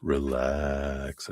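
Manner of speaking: low, soft, drawn-out calm; hushed, calming murmur; soothing. Real, not acted. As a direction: ease them down gently and quietly. Soothing reader channels the word relax.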